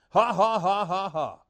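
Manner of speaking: loud defiant ha ha ha; mock laughing at the devil. Shown as a demonstration. Preacher laughing at Satan to show joy, loud mock laugh. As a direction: laugh at the enemy triumphantly. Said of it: "it's just good to start saying".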